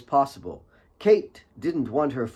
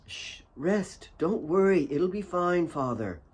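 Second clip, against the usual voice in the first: soothing daughter; 6 semitones higher, 3 dB quieter than usual. A narrator's reading. Kate voiced soothing dying father.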